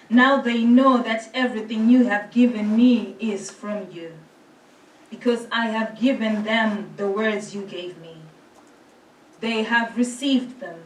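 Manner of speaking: normal talking voice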